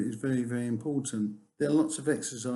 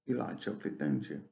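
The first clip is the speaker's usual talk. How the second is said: don't you drops low and soft; falling tag for confirmation; 10 semitones lower, 5 dB quieter, faster than usual. Shown as a demonstration. Teacher demonstrating a tag that just confirms, falling. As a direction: let the tag sink, no real question. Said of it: "if it goes down ... all you're looking for is confirmation".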